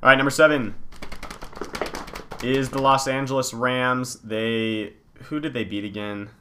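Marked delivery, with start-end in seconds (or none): 0.0-4.2 top ten countdown. Acted. Announces power rankings countdown.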